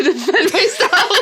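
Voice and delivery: laughing. Real, not acted. Player laughs at own helplessness.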